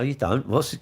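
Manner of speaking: normal talking voice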